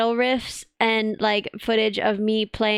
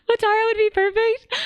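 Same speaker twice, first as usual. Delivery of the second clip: excited squeal; 10 semitones higher, 4 dB louder than usual. Real, not acted. Excited squeal about streamer.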